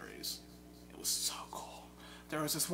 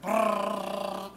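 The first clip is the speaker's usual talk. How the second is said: loud engine noise; 6 semitones higher, 9 dB louder than usual. Retold or imitated. Imitating game noise.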